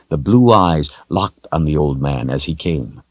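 ordinary delivery, nothing marked

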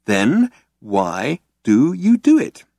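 slow, spaced-out question; slowly. A narrator's reading. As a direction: give each word time to land. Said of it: "he said slowly".

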